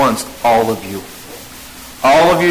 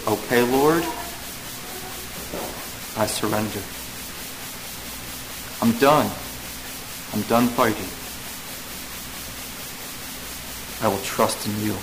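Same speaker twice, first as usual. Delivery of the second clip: a sinner's hushed prayer of surrender; quiet surrender prayer; 4 semitones lower, 11 dB quieter than usual. Pretend. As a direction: yield like a tired soul giving up. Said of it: "and you say".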